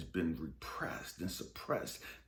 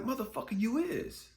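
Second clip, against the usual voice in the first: God's retort; 13 semitones higher, 4 dB louder than usual. Retold or imitated. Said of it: "It's like".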